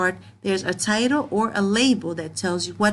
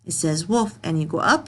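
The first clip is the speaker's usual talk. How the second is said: usual speaking voice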